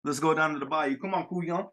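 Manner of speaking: rapid, thick Louisiana bayou accent. Shown as a demonstration. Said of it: "His accent's too thick".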